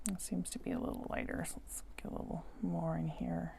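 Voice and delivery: soft whisper to herself; breathy aside while she works. Real, not acted. Muttering to self while painting.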